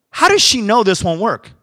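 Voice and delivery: high, loud burst of disbelief; retold incredulous question. Pastor challenging the translator about the woman's excuses, loud and incredulous. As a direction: raise pitch and volume, demanding an explanation.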